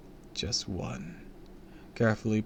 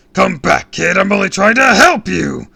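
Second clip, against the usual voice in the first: bellowing villain; 11 semitones higher, 18 dB louder than usual. A narrator's reading. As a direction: bellow it in comic rage. Villain chases hero calling.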